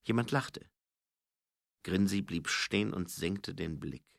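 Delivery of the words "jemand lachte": usual speaking voice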